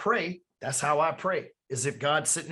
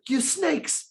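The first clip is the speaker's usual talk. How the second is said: higher-pitched imitation of a scolding Jesus; mock angry rebuke; 10 semitones higher than usual. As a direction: snap it at them like an angry preacher. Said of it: "he flipped the table".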